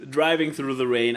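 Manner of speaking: ordinary talk, nothing marked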